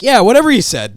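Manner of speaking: high loud sarcastic quip; Deadpool quip. Retold or imitated. Deadpool brushing off Gambit's unclear words, high loud quip. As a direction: toss it off mockingly. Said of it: "he was like".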